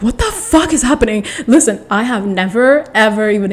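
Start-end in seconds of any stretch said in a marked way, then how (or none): 0.0-1.2 higher-pitched incredulous question; retold disbelief. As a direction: relive the shock, pitched up. Retold shock at jail threat.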